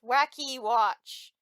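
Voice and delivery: loud gadget voice. Acted. Watch app voice announcement.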